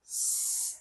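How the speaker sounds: a long whispered hiss through the teeth, স-; hissed s sound. Shown as a demonstration. Teacher shows students the hiss that সিন should make. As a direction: breathe out a drawn-out unvoiced স- hiss. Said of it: "এরকম একটা আওয়াজ আসবে".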